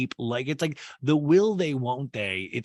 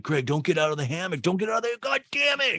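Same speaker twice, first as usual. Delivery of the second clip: mock pleading, climbing higher and higher; imitated frantic protest; 8 semitones higher, faster than usual. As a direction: act out escalating exasperation, ending high-pitched. Acting out Austin's frantic protest.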